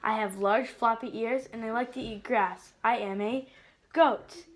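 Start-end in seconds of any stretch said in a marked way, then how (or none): none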